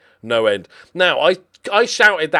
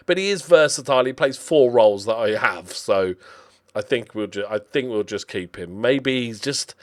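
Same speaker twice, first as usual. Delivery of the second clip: ordinary delivery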